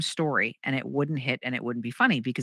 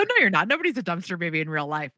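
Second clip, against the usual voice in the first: blurted denial; 3 semitones higher than usual. Retold or imitated. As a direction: blurt it out in acted disbelief. Retold blurted denial of neighbor's claim.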